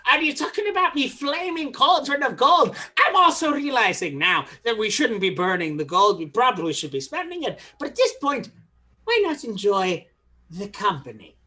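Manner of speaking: musing leprechaun brogue, falling away at the end; Irish accent. Acted. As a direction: reason aloud in a put-on Irish accent. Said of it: "making me do the accent".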